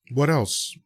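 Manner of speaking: ordinary talk, nothing marked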